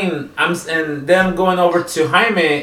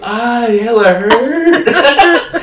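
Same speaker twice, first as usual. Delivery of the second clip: put-on laughing drunk whine; 7 semitones higher, much slower than usual. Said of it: "he goes".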